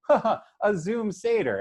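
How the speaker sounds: playful, put-on amusement; jovial. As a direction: imitate everyone finding it a cute novelty. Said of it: "kind of jovial about that".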